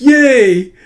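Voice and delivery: high, loud whoop; excited cheer. Acted. Manga character celebrating in a recap, excited cheer. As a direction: burst out with a big cheer.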